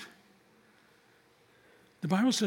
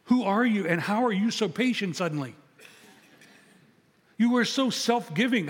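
higher and louder, half-laughing wonder; amazed disbelief; 5 semitones higher, 4 dB louder than usual. Retold or imitated. Father marveling at his son's new patience, amazed and laughing. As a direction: marvel at how much he's changed.

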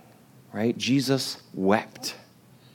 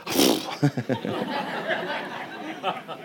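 frustrated snort like a horse, then a laugh; imitated horse snort; 7 dB quieter than usual. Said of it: "made a noise like a horse snort".

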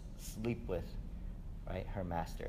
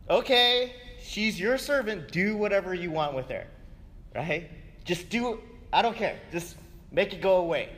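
higher, hurried, shrugging voice; passive Abram; 8 semitones higher, 6 dB louder than usual. Retold or imitated. Abram gives in to Sarah, a hurried shrugging tone. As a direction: brush it off, make it stop. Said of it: "He's like".

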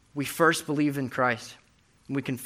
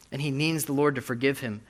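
ordinary talk, nothing marked

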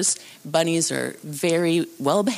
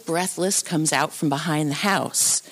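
ordinary talk

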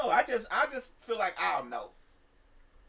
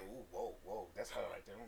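much lower and softer, secretive; hushed whisper; 11 semitones lower, 15 dB quieter than usual. Pretend. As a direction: murmur it so she can't hear. Pretending not to stare.

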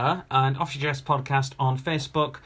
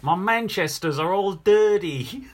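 higher-pitched put-on Aussie voice; hammed-up Australian accent; 5 semitones higher than usual. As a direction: say it like an Australian, rising at the end. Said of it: "Please say it in Australian".

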